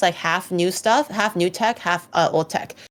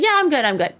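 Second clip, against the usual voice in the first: light, higher dismissive voice; breezy brush-off; 6 semitones higher, faster than usual. Retold or imitated. Friend shrugging off the warning, breezy. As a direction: wave it off, overconfident. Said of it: "He's like".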